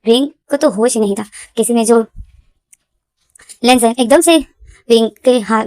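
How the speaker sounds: plain reading voice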